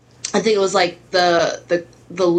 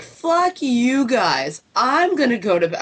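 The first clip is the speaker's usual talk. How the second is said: higher, very slow parroting of fuck you guys; mocking mom; 6 semitones higher, slower than usual. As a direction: mimic her own words back in a higher voice. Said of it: "And Mom's like".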